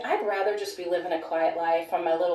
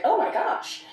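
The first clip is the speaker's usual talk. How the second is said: much higher mock gasp of surprise; imagined shock; 10 semitones higher, slower than usual. People hearing the whistleblowers' story, imitated shock. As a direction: exclaim it high, as astonished listeners would.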